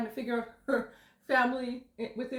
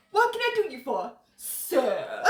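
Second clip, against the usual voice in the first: mock British; 5 dB louder, slower than usual. Amateur actor trying out British on a customer, mock British. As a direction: play the eager British server, falling on the last word. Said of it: "I've been watching Bridgerton".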